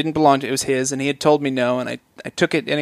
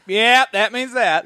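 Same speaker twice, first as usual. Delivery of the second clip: slow, smug mock pundit voice; mocking know-it-all; 7 semitones higher, 4 dB louder, slower than usual. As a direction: parody a self-satisfied armchair expert. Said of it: "And he's like".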